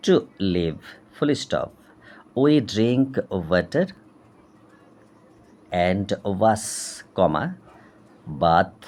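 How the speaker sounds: very slow, word-by-word textbook reading with pauses; dictation reading. Acted. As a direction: read it out for dictation, voicing each punctuation mark.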